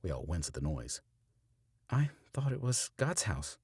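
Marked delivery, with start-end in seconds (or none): 1.9-3.6 calm. A narrator's reading. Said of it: "Stella asks calmly".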